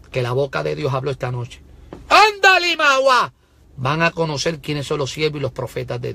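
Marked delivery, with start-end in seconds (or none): 2.1-3.3 very high, loud tongues outburst; preacher shouting in tongues, very high; ecstatic tongues. Real, not acted. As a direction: erupt in the spirit. Tongues outburst mid-sermon.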